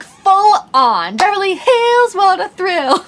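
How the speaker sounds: high, slow, gushing delight; thrilled and laughing; excited. Real, not acted. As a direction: savor it like a movie star. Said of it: "full on Phyllis Neffler".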